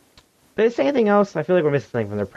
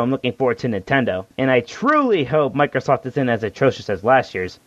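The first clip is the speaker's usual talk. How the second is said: normal talking voice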